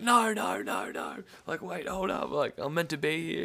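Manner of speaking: high, loud, frantic no no; pleading. Retold or imitated. Begging the guard to let him stay, high and frantic. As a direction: beg your way out of trouble.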